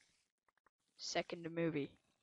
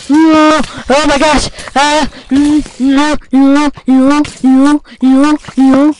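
loud, higher run of no; panicked yelled protest; 4 semitones higher, 7 dB louder, slower than usual. Real, not acted. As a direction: protest loudly. Friend shows up on camera.

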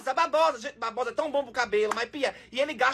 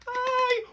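long high sigh of delight; high-pitched "ai" squeal; 12 semitones higher than usual. Real, not acted. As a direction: let out a high, drawn-out "ai". Delighted by satisfying video.

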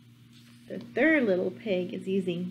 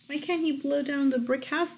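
ordinary delivery, nothing marked

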